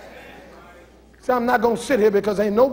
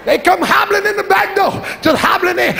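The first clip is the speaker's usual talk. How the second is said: high, strained voice of a pregnant woman hobbling in; 7 semitones higher than usual. Retold or imitated. Preacher mimics a pregnant churchgoer, high and strained. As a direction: act out the pregnant woman hobbling through the door.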